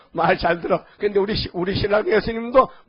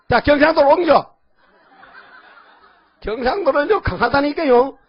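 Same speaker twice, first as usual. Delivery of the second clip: louder and higher, laughing; laughing boast; 7 semitones higher, 6 dB louder than usual. Real, not acted. Preacher boasts to congregation about Gyeongsang, laughing loudly. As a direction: crow it through a laugh.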